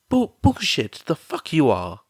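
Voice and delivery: loud sudden protest, high on the first word. A narrator's reading. Mother protesting to her friend, loud high outburst. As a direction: cut her off hard with a high, loud bullshit. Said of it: "Mitsuki protested".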